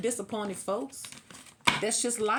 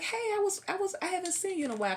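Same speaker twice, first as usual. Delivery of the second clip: high, fast chirpy greeting; lightly voiced cheerful shop lady, high and quick; 11 semitones higher, faster than usual. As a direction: chirp it like a friendly shopkeeper. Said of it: "she was like".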